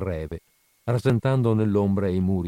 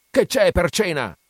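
loud, much higher yell; 9 semitones higher, 5 dB louder than usual. A narrator's reading. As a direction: bang the table and shout it. (urlo furioso)

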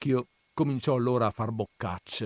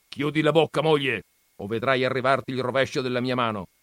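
gruff grandfather, raised and quick; mock threat; 4 semitones higher, 6 dB louder, much faster than usual. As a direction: bark a half-serious threat at the wife. (minaccia burbera del nonno alla moglie, rapida)

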